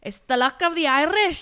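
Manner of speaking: loud, high, hammed-up Irish accent. Shown as a demonstration. Friend retries the phrase, loud hammed-up Irish accent. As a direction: belt out a mock Irish brogue. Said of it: "Let me do it again".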